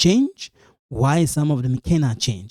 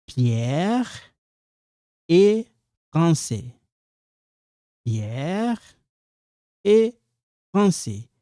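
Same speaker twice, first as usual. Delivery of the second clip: "Pierre ... est ... français" with long pauses between words, "est" pitched up; word-by-word demonstration; 3 semitones higher, slower than usual. Shown as a demonstration. French teacher showing learners each word, spaced-out pronunciation. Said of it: "I'm trying to stress this".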